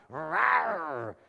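mock roar of frustration; imitated angry growl. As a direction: growl like someone railing at heaven. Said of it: "he'll shake his fist at God".

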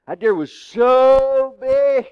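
hunter bragging at full volume. Retold or imitated. Hunter bragging to friends about a huge deer, shouting.

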